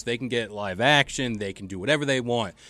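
ordinary delivery

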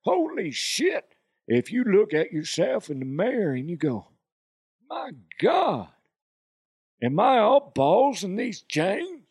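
high, whooping comic cowboy; Cowboy DeHuff. Acted. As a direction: stretch it out like an excited cowboy. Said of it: "Then there's Cowboy DeHuff".

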